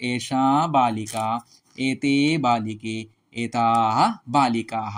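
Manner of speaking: slow, deliberate chanting of pronoun phrases; slow recitation. Shown as a demonstration. Teacher reciting Sanskrit pronoun phrases to students, slowly. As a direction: say each pair slowly for students to copy.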